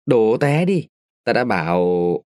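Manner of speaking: ordinary delivery, nothing marked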